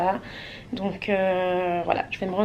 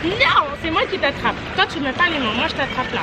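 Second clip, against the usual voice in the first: higher and louder, playful teasing; 7 semitones higher, 5 dB louder than usual. Real, not acted. Playing with Kylian at pool.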